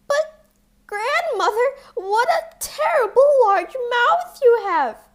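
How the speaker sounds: very high, loud alarmed cry; terrified at the huge mouth; frightened girl. A narrator's reading. Girl's last frightened question.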